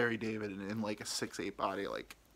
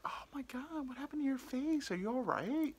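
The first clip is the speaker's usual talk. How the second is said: put-on high, concerned old-lady voice; imitated old lady; 13 semitones higher, 4 dB quieter than usual. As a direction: fret in a high, thin old lady's voice. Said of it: "this ninety-year-old lady".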